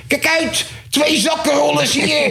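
very high-pitched yelled warning, imitated; mock shouting. Thief spotter warning the whole fair, high mock shouting. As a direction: imitate an overexcited alarm raiser yelling at top pitch. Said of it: "loopt hij te schreeuwen op die kermis".

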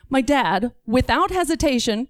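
ordinary delivery

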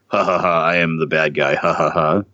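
hammy cartoon villain with a mock evil laugh; mustache twirling villain. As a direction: camp it up as a generic bad guy laughing "ha ha ha". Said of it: "type guy in this type of movie".

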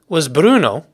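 ordinary talk

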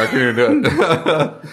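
ordinary talk

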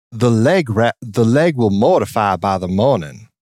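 drawled Southern line without the R; imitating a non-rhotic Southern belle. Imitating Nicole Kidman's non-rhotic Southern line. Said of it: "but Nicole Kidman goes".

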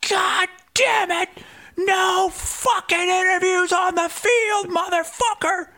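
high, slow, furious mock yell; angry shout. Michigan State staffer cursing at reporter, high mock yell. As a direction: bark it like an enraged security guy. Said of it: "shoves me out of the way".

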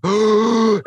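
loud high gasp of panic; panicked gasp. Retold or imitated. Telling of the missing visa, a loud panicked gasp. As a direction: gasp like your heart just dropped. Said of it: "my heart goes like".

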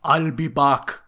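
loud Austrian accent; deadpan action-star line in a thick Austrian accent; Terminator. Shown as a demonstration. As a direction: do a hammy Austrian cyborg. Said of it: "you can do the accent if you want to".

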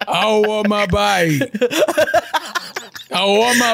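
loud, stretched-out child impression; wailing little brother. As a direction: draw out the wail, mimicking him. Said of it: "And he used to".

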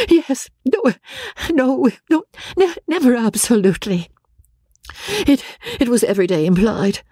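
high, halting, distressed voice; faltering Marianne. Acted. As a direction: stumble through a painful admission. Marianne falters through her denial.

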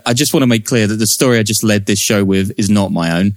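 ordinary delivery

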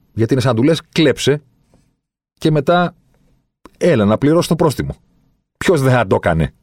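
loud, high, exasperated mock offer; worked-up, incredulous appeal; indignant sarcasm. Real, not acted. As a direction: push the pitch right up in outrage. Outraged imagined offer to a thief.

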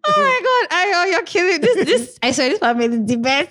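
high delighted shriek; excited. Real, not acted. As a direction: squeal with delight. Overwhelmed with laughter.

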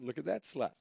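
lightly acted heckling call; mock heckle. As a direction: copy a commentator's jeer. His own heckle, copying the catchphrase.

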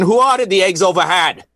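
hammed-up Boston accent. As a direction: call it out in a broad Boston accent. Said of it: "And then in Boston".

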